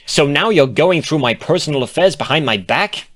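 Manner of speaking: loud, high, offended comeback; indignant retort. A narrator's reading. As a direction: throw it back at her.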